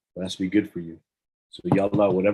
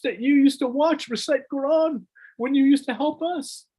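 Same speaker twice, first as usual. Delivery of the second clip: high, rising lament, near breaking; anguished plea; 3 dB louder than usual. Retold or imitated. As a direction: she begs to be known, voice climbing higher and higher. Zainab's anguished plea, retold.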